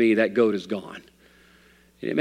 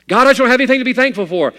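loud, much higher, fast whiny protest; mock complaining prayer; 12 semitones higher, 9 dB louder, faster than usual. A discouraged believer to God, mock whining. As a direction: cry it out like a sulking believer.

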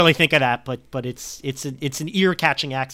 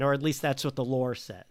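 ordinary talk, nothing marked

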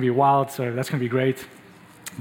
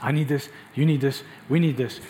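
ordinary delivery

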